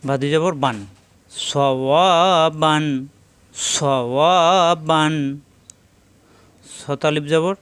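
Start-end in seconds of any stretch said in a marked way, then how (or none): 1.3-5.1 "সওয়াবান" slowly with long middle "ওয়া"; one-alif madd. Shown as a demonstration. Teacher drills madd word, slow drawn-out "সওয়াবান". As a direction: stretch the middle vowel of "সওয়াবান". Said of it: "এক আলিফ টান, মাদের হরফ".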